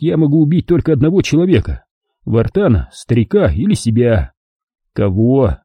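ordinary delivery, nothing marked

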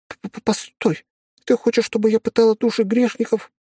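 put-on high young girl, stammering in shock; stunned girl stammering; young woman voice. Girl's stammering reply.

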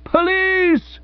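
high, yipping call for police; old man's high yelp; yipping in the tenor clef. Acted. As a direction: yelp like a small terrier. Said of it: "yipped old Bassett up in the tenor clef".

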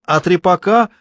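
put-on high, young heckling call; ringing girlish voice. A narrator's reading. Girl in the hall asks about folk dances, ringing and high. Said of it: "молодой звонкий девичий голос из зала выкрикнул".

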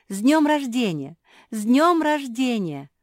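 high, loud festive call; birthday cheer. A narrator's reading. Family congratulates Куки on her birthday, cheering loudly.

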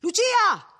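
high-pitched searching shout; calling out. A narrator's reading. Villagers searching the house for the women, calling out. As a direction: call into an empty house. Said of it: "Si chiama".

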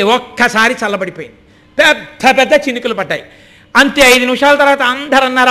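ordinary talk

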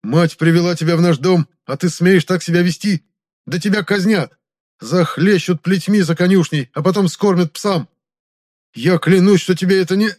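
loud, high-pitched indignant threats; high, heated outburst; outraged threatening. Acted. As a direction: lash out loudly like an insulted young noble. Captive nobleman threatens, then pleads, cut off.

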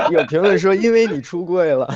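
words spilling out through loud laughter; giggling through a cheeky comment; laughing. Real, not acted. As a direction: read it while cracking up. Laughing while reading a comment.

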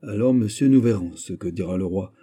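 plain reading voice

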